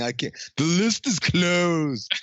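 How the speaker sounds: slow, prim "The list is closed"; snooty doorman. Pretend. Sock maker turns him away at party, prim slow refusal. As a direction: drag out the refusal like a fussy doorman. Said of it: "somebody who makes socks tells me".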